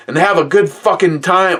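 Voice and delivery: first take, with the word "fucking"; worked-up grandpa, wrong word. Retold or imitated. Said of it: "Or, no, actually, sorry, sorry".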